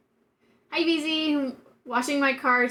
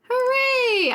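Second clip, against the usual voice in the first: high, bright hooray; little cheer, pitched high; 12 semitones higher, 3 dB louder than usual. Real, not acted. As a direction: celebrate the win. Celebrating clips doing well.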